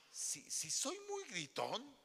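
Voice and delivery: slowly, dragging the admission; hesitant slow confession. Pretend. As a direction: stretch it out, dreading the answer. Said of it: "le va a decir".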